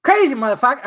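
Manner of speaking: a badly faked Chris Rock rant; Chris Rock attempt. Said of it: "trying to pinpoint a Chris Rock impression".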